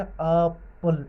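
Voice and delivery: एप्पल slowly, long open first vowel then 'pul'; sounded out. Shown as a demonstration. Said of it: "ए आ रही है ना". (एप्पल धीरे-धीरे, पहला स्वर खींचकर)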